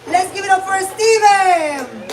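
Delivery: high, loud, drawn-out stage introduction; announcer shout. Real, not acted. Host hyping a crowd for the next comic, announcer shout. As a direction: call the act up with big hype.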